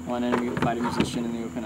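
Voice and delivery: fast. Real, not acted. Radio readback of target.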